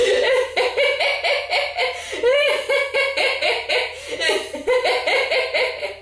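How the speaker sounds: very high long laughter; high sustained giggle; group throat laugh. Shown as a demonstration. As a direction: keep laughing on "é" with everyone. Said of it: "Avec moi tous ensemble".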